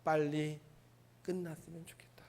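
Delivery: faint unvoiced whisper of exhaustion; weary whisper. Preacher voicing Jonah worn out in the heat. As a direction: sigh it out as if parched. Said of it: "무슨 생각이 들까요?".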